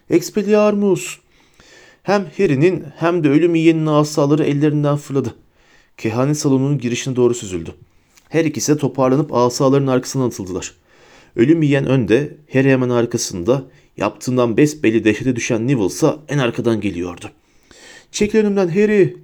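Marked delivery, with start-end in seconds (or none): 0.1-1.2 high, drawn-out spell cry; frantic shouted spell. A narrator's reading. Neville aiming wildly at the fighters, shouting. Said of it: "çılgınca doğrultarak bağırdı". 18.1-19.2 high, urgent cry at moderate volume; shouted warning. A narrator's reading. Neville yelling at Harry to move, shouting. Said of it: "diye haykırdı Neville".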